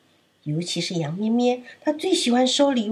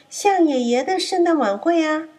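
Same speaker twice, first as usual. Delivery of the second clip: sly wolf; 8 semitones higher, 3 dB louder than usual. Acted. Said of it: "大野狼说：".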